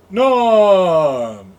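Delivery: loud group cheer of a name; bar crowd shouting Norm. Retold or imitated. Regulars in a bar greeting Norm, loud cheer. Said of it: "the bar is like".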